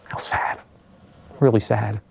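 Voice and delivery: hushed, voiceless aside; sad whisper. Real, not acted. Storyteller recalling the clouded skyline, sad whisper. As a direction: let it out softly as a whisper of sorrow.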